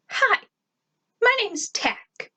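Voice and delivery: put-on very high, drawn-out girlish voice; put-on high little voice; high-accented girl. A narrator's reading. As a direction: pitch it way up for a new girl's introduction. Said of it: "said in a high accent".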